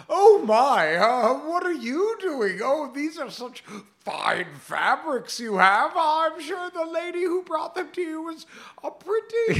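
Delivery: high, loud hammed-up feigned surprise; mock maiden. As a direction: gush in a put-on damsel voice. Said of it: "so she shows up and goes".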